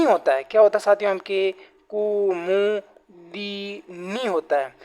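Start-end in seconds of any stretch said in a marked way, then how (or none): 1.1-4.7 Kumudini stretched out very slowly; very slow drilled word. Shown as a demonstration. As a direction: draw each syllable of Kumudini out for the class. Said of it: "teacher drills the right spelling".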